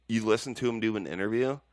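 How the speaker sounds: ordinary talk, nothing marked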